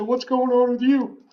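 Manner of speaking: high, booming stranger's question, lightly voiced; imitating Tommy. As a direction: press him like a pushy stranger. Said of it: "earth-shattering pitch to his voice".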